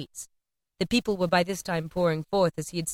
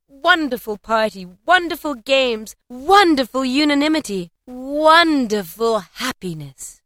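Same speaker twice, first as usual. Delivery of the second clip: loud, swelling repetition; rapturous narration; 5 semitones higher, 6 dB louder, slower than usual. A narrator's reading. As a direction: stretch each wonderful higher and louder, slowing at the end. Narrator revels in the party.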